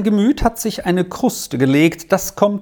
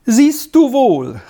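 higher, louder, drawn-out happy call; delighted exclamation; 8 semitones higher, 5 dB louder, slower than usual. A narrator's reading. Castella tells his wife he was right, delighted exclamation. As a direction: exclaim it with slow, pleased triumph. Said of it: "rief Castella erfreut aus".

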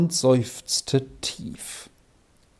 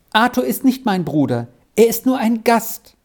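higher, lightly voiced child; correcting; 8 semitones higher than usual. A narrator's reading. Squirrel corrects the professor about Arthur, childlike. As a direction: put him right in a high little voice. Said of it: "berichtigte Squirrel".

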